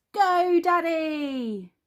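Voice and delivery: high, loud, drawn-out cheer. A narrator's reading. Peppa and George clapping for Daddy, high excited cheer. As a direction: cheer him on in a high child's voice.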